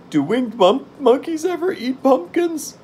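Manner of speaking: very high, frightened character voice; acted high-pitched dread; shiver of fear. A narrator's reading. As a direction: squeak the question out in fear. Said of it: "asked Jack with a shiver of fear".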